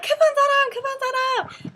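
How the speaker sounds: high-pitched, loud call; ajumma calling out. Retold or imitated. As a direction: imitate an ajumma calling down a queue. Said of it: "she comes and she's like".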